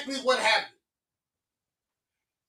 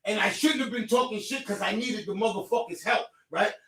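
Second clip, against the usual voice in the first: usual speaking voice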